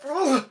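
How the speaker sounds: frustrated groan. Real, not acted.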